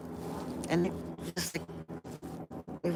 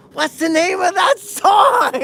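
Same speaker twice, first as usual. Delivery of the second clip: much higher, louder shout with a laugh in it; shouted question; 10 dB louder than usual. Retold or imitated. Yelling up to the neighbor to learn the song. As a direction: yell the question up eagerly. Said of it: "He's like".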